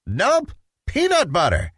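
put-on high, insistent little boy; stubborn child insisting; insistent Petey. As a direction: plant your feet and insist like a kid. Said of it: "insisted Petey".

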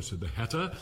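usual speaking voice

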